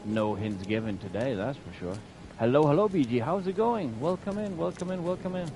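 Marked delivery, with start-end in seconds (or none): 2.4-5.5 bright, high sing-song welcome; cheery greeting. Real, not acted. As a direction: greet the chat cheerfully, pitched up. Cheery streamer greeting chat.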